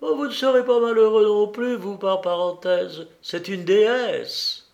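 high, tearful-sounding exclamation; through sobs. A narrator's reading. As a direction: wail it, overcome with emotion. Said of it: "à travers ses sanglots".

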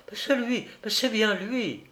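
high-pitched female witness; high, certain cry of recognition; woman's insistent affirmation. A narrator's reading. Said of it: "madame Masson de nouveau s'affirma".

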